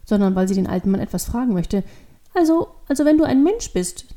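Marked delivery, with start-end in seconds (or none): 2.3-4.1 put-on higher, halting child voice; hesitant child. A narrator's reading. Devil girl begins her question afresh, hesitant child. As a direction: start over, fumbling for the request. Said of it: "beginnt sie erneut".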